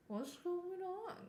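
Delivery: high, slow, soft puzzled-puppy voice; dog's puzzled voice. Retold or imitated. The dog watching the standoff, voiced as high and puzzled. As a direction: voice the confused dog wondering what's happening. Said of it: "The bean is just standing there like".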